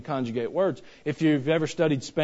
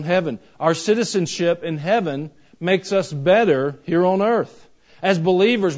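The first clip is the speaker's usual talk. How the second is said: louder, higher pulpit delivery; full-force sermon voice; fiery preaching; 4 semitones higher, 7 dB louder than usual. Acted. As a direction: drive each phrase home to the congregation.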